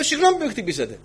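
high, mock-polite apology; sweetly sarcastic sorry; ironic apology. Preacher needles the woman who shoved him, ironically. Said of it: "κατάλαβε την ηρωνία μου".

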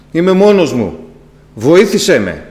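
retold desperate prayer; loud plea. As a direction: beg God not to be left alone.

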